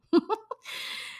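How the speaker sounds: high, breathy giggle; a real laugh bursting out; a bright laugh at her own words. Real, not acted. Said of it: "Ah, yo como que me río".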